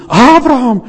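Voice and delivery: shouted high and loud, thrilled; excited cry. Retold or imitated. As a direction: call out the name in rapture. (begeistert ausgerufen, hoch und laut)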